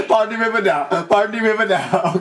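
sing-song Jamaican dancehall mimicry; imitating Jamaican accent. Player copying the Elephant Man song in a Jamaican accent. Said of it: "I can't do that Jamaican accent".

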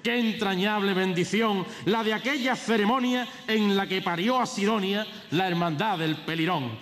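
high, ringing proclamation of verse; pregón herald. Acted. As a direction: declaim it like a festival herald.

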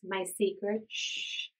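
usual speaking voice

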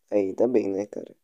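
ordinary talk, nothing marked

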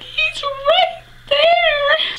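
very high, loud, drawn-out squeal; hammed-up high-pitched blubbering; mock hysterical wail. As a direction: imitate a starstruck sobbing wail, stretched out slowly. Retells her hysterical crying.

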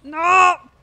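sudden loud yelp of panic; screaming while falling. Real, not acted. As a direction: shout as you slip away. Falling off the ice.

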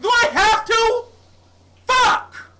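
high, loud frustrated shout; exasperated yell. Real, not acted. Exasperated outburst.

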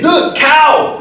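"The cow" jumps high and loud in disbelief; mock puzzled question. Teacher mocking the farmer's word for cow, high and loud. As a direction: squeal it like it makes no sense. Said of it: "So why is he saying".

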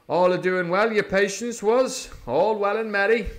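put-on high, loud, jovial old sea cook; hearty pirate patter; beaming Silver. A narrator's reading. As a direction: chatter on with false cheer. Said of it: "beaming with good nature".

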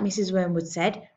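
usual speaking voice

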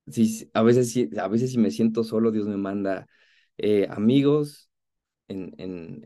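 ordinary talk

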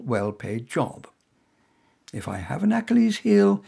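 ordinary delivery

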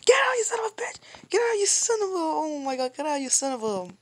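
loud, high screamed cursing; panicked yelling. Real, not acted. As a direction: scream at the attacker to get away, pitch gradually dropping. Yelling at the attacker.

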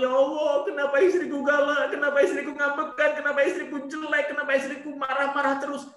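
high, fast mock wailing husband; hammed-up complaint, rattled off high; mock oversensitive lament. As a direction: play the overwrought husband pleading to heaven. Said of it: "Jadi nggak usah baper".

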